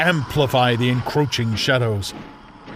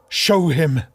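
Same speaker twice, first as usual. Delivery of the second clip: higher, louder curt command; a firm sister giving an order; 5 semitones higher, 4 dB louder than usual. Acted. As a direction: snap out the order. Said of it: "she ordered".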